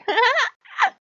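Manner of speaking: gleeful high laugh; loud amused laugh; high delighted god laugh. Retold or imitated. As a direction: cackle with glee as the god. Odin's laugh voiced.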